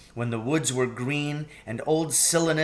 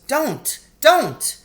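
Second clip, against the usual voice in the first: distressed pleading; 8 semitones higher, 4 dB louder than usual. A narrator's reading. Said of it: "in great distress".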